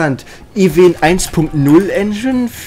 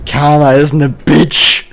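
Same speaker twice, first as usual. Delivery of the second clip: slow, emphatic; 5 dB louder, much slower than usual. Real, not acted.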